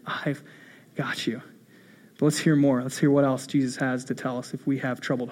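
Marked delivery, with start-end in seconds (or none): 0.1-1.4 softly whispered, much softer. Shown as a demonstration. Pastor whispering reassurance to the church, hushed. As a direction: whisper it close like a secret promise.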